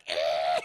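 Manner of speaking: low, loud mock shriek; startled yelp. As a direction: yelp in shock. Kiko groped, freaks out.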